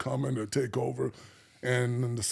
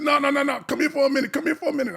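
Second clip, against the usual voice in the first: high, insistent beckoning; pitched-up, urgent come-here call; unlowered voice; 10 semitones higher, faster than usual. Retold or imitated. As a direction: imitate the sister calling him over. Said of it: "she did not lower her voice at all".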